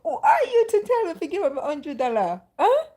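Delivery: high, loud, fast indignant sass; sassy retort. Retold or imitated. To the Holy Spirit asking for her money, high indignant sass. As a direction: talk back high and indignant.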